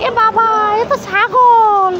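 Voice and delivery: put-on slow, high, playful astonishment for the child; mock surprise. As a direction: drag it out in pretend shock at the goat. Mock shock at goat. (বাচ্চাকে মজা করে ছাগল দেখিয়ে, টেনে টেনে নাটুকে বিস্ময়)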